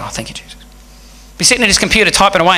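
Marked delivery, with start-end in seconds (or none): 0.0-0.5 very soft low murmur; under his breath. Retold or imitated. Imitating the coworker at the coffee, barely audible. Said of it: "you'd see him go".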